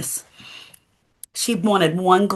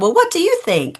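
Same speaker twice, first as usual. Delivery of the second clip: playful tease; 12 semitones higher than usual. Acted. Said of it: "Corrie laughed and she said".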